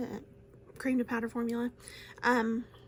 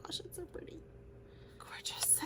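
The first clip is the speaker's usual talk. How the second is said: high, hushed whisper of delight; whispered wonder; awed whisper; 7 semitones higher, 11 dB quieter than usual. Real, not acted. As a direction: barely breathe it, in awe. Awed by shade on finger.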